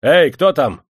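loud, high summons; officer's loud shout; calling out. A narrator's reading. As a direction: call the guards from the next room. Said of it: "выкрикнул офицер".

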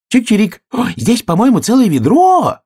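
very high, loud chirpy voice; excited little bird squeal; astonished sparrow. A narrator's reading. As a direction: chirp it out in amazement.

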